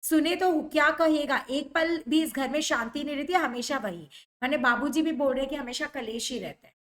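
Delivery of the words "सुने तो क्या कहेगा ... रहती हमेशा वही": normal talking voice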